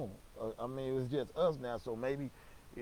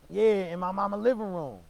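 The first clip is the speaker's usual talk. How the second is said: high, loud emphatic confirmation; uncle's loud answer; 9 semitones higher, 12 dB louder than usual. Retold or imitated. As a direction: hammer it home like an outraged uncle. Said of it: "He was in the house".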